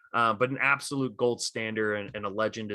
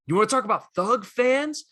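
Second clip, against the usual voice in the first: much higher, heated comeback voice; 10 semitones higher, 4 dB louder than usual. Retold or imitated. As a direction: fling the challenge back at him, pitched way up. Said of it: "[name] was like".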